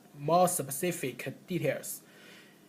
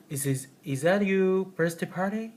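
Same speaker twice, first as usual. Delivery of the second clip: higher, guessing rise to the end; unsure rising question; 5 semitones higher, 4 dB louder than usual. Shown as a demonstration. Teacher showing a guess about a party, rising. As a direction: ask it like a guess. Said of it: "it's also rising intonation".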